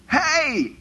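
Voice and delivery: high, urgent holler; shouting over storm. Acted. Paul hailing seasick men in a storm, high shout. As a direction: call out over a raging sea. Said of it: "It's more like".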